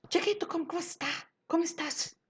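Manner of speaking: high exclaiming voice from the very first word; entonação desde o começo. Shown as a demonstration. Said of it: "eu já dou entonação no co". (exclamação aguda desde o começo)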